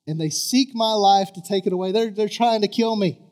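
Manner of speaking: loud aggrieved complaint; a wronged prophet pleading; griping to God. Retold or imitated. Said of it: "he's griping to God".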